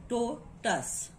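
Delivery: "to" and "tus" as two separate beats; syllable breakdown. Shown as a demonstration. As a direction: split "to, tus" with a gap between syllables. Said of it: "two syllable is here".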